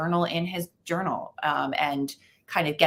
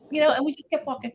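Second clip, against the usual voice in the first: ordinary talk, nothing marked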